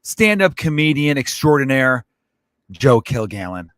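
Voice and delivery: loud, high podcast host opener; show intro announcer. Acted.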